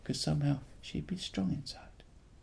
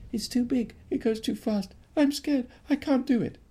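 put-on high whiny frightened boy; scared boy; 9 semitones higher, 4 dB louder than usual. Boy afraid of the big slide, high scared whine. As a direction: plead in a high small scared voice. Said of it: "who said".

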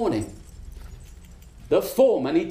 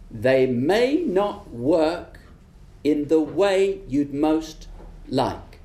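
very slow, lower, knowing; slow knowing witch; 5 semitones lower, much slower than usual. Acted. Witch warning the foreman, slow and low. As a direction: let each word land like a warning. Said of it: "she continued".

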